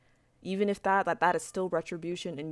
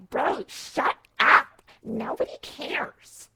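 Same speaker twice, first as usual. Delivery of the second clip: slow, loud, higher sneering put-on voice, then drops to a breathy hush; mock the rude player, dragging it out; rude gamer voice; 8 semitones higher, 8 dB louder, much slower than usual. Mocking the rude Roblox player who told her to shut up, in a slow sneer. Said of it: "I'm doing a voice for him".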